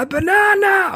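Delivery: put-on a little kid thrilled by a banana; delighted child. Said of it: "وانبسط".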